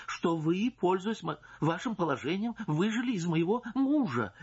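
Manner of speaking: offended indignation. Acted. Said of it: "Она оскорбилась".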